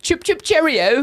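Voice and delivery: high-pitched mock British accent; bad British accent. Shown as a demonstration. Teased host showing off, bad British accent. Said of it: "when you do a British accent".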